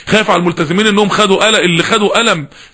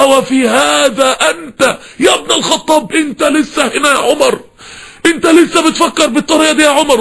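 high, slow, crying reproach; a voice breaking with grief as it rises; tearful rebuke; 6 semitones higher than usual. Acted. The Prophet reproaching Umar, higher and tearful. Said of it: "النبي غضب".

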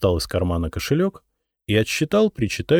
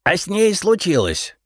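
злорадно; 12 semitones higher, 3 dB louder than usual. A narrator's reading. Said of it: "злорадно хихикнул Репей".

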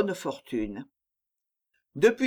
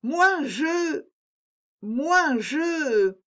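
flustered stammer; 9 semitones higher, much slower than usual. A narrator's reading. Countess stammers before the poet.

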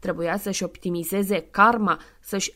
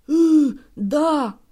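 put-on meek old man; 6 semitones higher, 7 dB louder than usual. Santa meekly agreeing.

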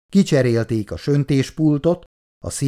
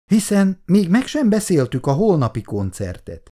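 astonished; 8 semitones higher than usual. A narrator's reading. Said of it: "álmélkodott Tibor".

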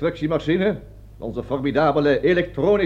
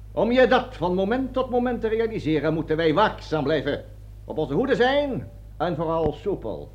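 normal talking voice

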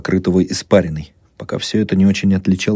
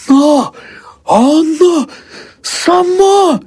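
very high, loud, horrified exclamations; panicked cry; 5 dB louder, much slower than usual. A narrator's reading. Husband recoiling from daughter-in-law, panicked shriek. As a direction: cry out each word in terror. Old man recoils from possessed woman.

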